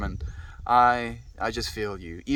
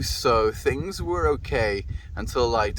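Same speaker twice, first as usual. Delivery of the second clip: usual speaking voice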